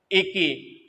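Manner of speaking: normal talking voice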